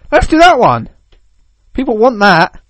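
high, loud put-on voice; eager developer. Developer picking the easy option, high eager voice.

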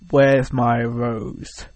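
very slow, drawn-out villain quote; Josh Brolin as Thanos. Retold or imitated. Thanos actor demanding his rose from Robert, imitated very slowly. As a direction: stretch every word out slowly and heavily, imitating a menacing big guy. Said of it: "looks at Robert, and just says".

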